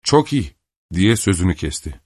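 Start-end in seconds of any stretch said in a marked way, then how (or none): 0.0-0.6 higher, cold clipped reply; cold and curt. A narrator's reading. Kister accepting the duel, cold and curt. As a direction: cut him short, coldly. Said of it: "soğuk bir davranışla kesik kesik".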